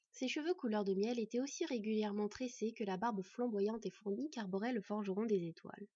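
plain reading voice

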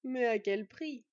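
the thief relishing the price, slow; malicious exclamation. Acted. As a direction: savour his spite slowly. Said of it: "s'exclama le voleur avec une lueur malveillante".

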